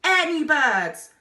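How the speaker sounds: very loud, high and slow roar; roaring over the engine. A narrator's reading. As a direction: bellow the warning. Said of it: "roared the explorer".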